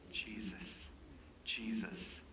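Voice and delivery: put-on hushed child's word. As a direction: breathe the name gently like a small child.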